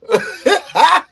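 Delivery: loud, high burst of laughter. Real, not acted. Streamer cracks up at his own country ways, laughing.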